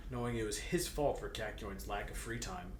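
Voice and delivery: usual speaking voice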